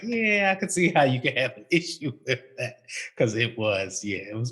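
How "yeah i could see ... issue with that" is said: laughing aside. Retold or imitated. Quoting own reaction.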